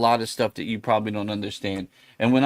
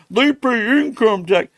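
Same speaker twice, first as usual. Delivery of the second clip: higher, slow mocking voice of clueless people; mimicking what people claim, in a higher, slow taunt; mocking quote; 9 semitones higher, 4 dB louder, slower than usual. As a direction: parrot them in a higher, slower sneer. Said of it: "People are like".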